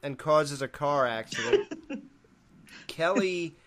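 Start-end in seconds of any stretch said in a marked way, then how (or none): none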